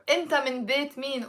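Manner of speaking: rattled off fast like everyday speech; quick casual phrasing. Shown as a demonstration. Teacher showing how locals fire off the question fast. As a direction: toss it off quickly.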